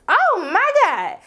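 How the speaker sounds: high, drawn-out exclamation of shock. Retold or imitated. Vlogger reacting to the boyfriend's selfishness, shocked. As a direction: stretch it out in scandalized disbelief. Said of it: "I was just shocked".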